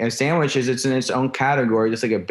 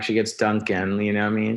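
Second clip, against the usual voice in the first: ordinary talk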